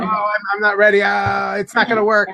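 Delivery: chuckling through the hesitant friend's excuse; laughing mock friend. Retelling his friend backing out, laughing. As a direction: laugh while imitating the nervous friend. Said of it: "It's like".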